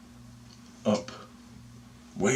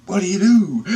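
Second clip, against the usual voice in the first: high, loud retold question; amazed question; 12 semitones higher, 9 dB louder than usual. Teller asks lady of light, loud amazed question. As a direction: blurt it out in mock wonder. Teller's startled eager reply.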